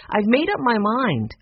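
high, insistent, mildly heated; arguing. A narrator's reading. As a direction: insist in the middle of a quarrel. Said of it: "Someone was arguing".

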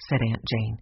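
ordinary delivery, nothing marked

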